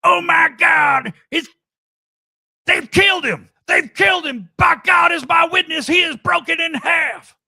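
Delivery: loud frantic wrestling announcer shout. Pretend. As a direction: scream it like JR calling a brutal spot. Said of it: "you do a J R voice".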